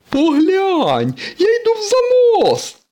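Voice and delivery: very high, loud show-off shout; excited boasting. Acted. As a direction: brag at the top of your voice. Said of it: "похвалився сирник". (хвалькуватий вереск)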